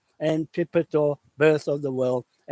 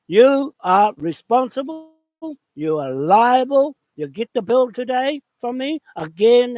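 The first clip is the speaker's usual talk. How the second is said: higher, louder, pointing the finger; furious address to the colonizers; angry accusation; 9 semitones higher, 5 dB louder than usual. Real, not acted. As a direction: declare them liable, raised and hard.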